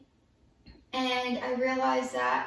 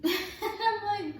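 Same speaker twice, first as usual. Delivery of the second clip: rueful laugh; 8 semitones higher than usual. Real, not acted. Laughs on hearing of infection.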